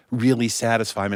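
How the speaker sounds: plain reading voice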